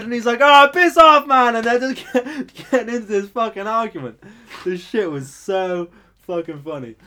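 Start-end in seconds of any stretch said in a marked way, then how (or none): 0.4-1.6 very loud, high mock yell; hammed-up angry dismissal; imitated angry chef. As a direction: act out a chef shouting someone away. Retold chef's angry reply.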